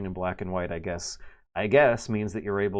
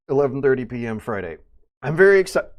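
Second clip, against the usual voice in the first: hiccuping mid-sentence; breaking off on a hiccup; 3 semitones higher, 7 dB louder, faster than usual. Real, not acted. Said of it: "I got the hiccups".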